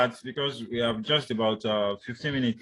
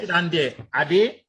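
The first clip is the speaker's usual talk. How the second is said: loud, higher order, "Ade" lifted at the end; fall-rise command; 5 semitones higher, 6 dB louder than usual. Shown as a demonstration. Teacher showing a command ending in a name, fall-rise. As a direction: command firmly, then tip "Ade" upward. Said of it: "fall rise intonation".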